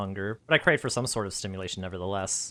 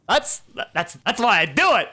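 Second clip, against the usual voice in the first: gleeful boast; 10 semitones higher, 8 dB louder than usual. Real, not acted.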